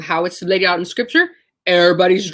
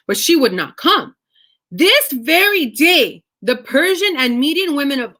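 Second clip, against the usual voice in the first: raised, declaiming reading voice; scripture reading; 6 semitones higher than usual. Acted. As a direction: proclaim the verses like a herald. Reads scripture aloud.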